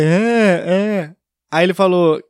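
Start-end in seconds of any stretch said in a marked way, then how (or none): 0.0-1.2 noncommittal nasal mumble; muffled fanho voice. Retold or imitated. Accused of drinking, he agrees in muffled nasal voice. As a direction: imitate agreeing without opening up the words. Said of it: "achou que eu tava bêbado".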